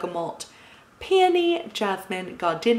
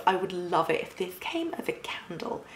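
whisper; 6 dB quieter than usual. Real, not acted. Confiding aside about a candle.

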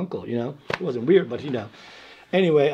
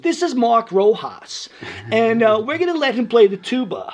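lightly voiced teacher, higher and louder; teacher announcing; 9 semitones higher, 5 dB louder than usual. Retold teacher announcing to the class.

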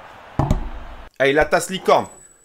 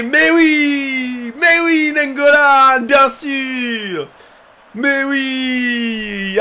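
excited cheering; 5 semitones higher, much slower than usual. Real, not acted. Streamer gloating to viewers over his pull, excited cheer. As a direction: crow with delight. Still celebrating.

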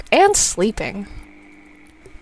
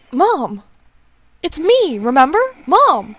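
louder, urgent call; 5 semitones higher, 6 dB louder, slower than usual. Acted. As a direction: try to make her remember you. Son calls to mother.

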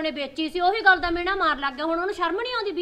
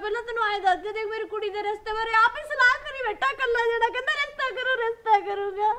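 higher, upset, close to tears; tearful complaint; 7 semitones higher than usual. Acted. Sister complaining through tears about her husband, tearful. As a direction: pour out the hurt to her sister. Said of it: "ਤੂੰ ਰੋਂਦੀ ਕਾਹਤੋਂ ਆ?".

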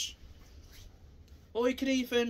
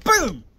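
one sudden blasting boom; explosive punch sound; shouted boom; 3 semitones higher, 15 dB louder than usual. Shown as a demonstration. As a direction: slam the word out like a punch landing.

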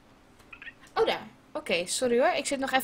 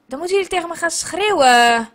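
louder, fed up with the game; loud frustrated call; 3 semitones higher, 11 dB louder, faster than usual. Real, not acted. Streamer calls out to chat, loud and exasperated.

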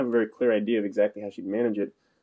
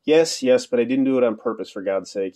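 usual speaking voice

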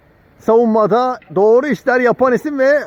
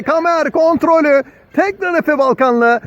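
usual speaking voice

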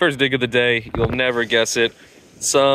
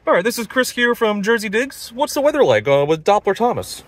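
news anchor voice; 7 semitones higher than usual. Acted. Mock newscast handing to weatherman.

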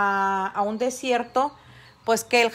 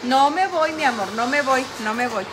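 calling out reassurance; 6 dB louder than usual. Real, not acted. Calling reassurance to her pets.

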